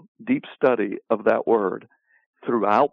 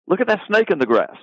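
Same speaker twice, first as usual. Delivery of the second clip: higher, urgent call of a real snake spotted; alarmed warning; 7 semitones higher, faster than usual. Retold or imitated. Out hunting in a field and warning a friend, alarmed. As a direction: point it out like a hunter who just saw a snake. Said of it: "If we're out in a field hunting".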